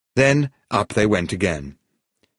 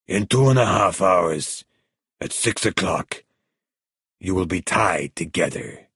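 controlled, unhurried menace; menacing crime boss. A narrator's reading. Villain explains his execution plan.

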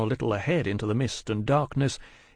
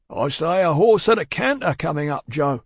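raised, higher coachman's voice; urgent report; 5 semitones higher, 5 dB louder than usual. Acted. Coachman warning guard of a horse, urgent call. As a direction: call out a warning of someone approaching.

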